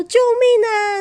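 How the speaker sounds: high, loud cartoon cry for help; mock panic. Moose panicking at the hedgehog, high loud mock yelp.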